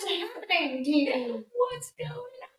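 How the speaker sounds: high squeaky dog voice; confused dog voice. Pretend. High dog voice barking at its owner. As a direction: play the baffled barking dog.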